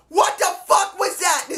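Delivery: high, shouted outrage; outraged shout. Real, not acted. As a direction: let it explode at the top of your voice. Third repeat, breaks into shout.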